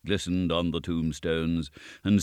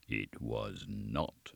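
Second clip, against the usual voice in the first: low, soft and very slow; a deep voice out of nowhere, drawn out; 9 semitones lower, 14 dB quieter, much slower than usual. A narrator's reading. Unseen goblin answers Gabriel in the graveyard, deep voice. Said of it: "said a deep voice".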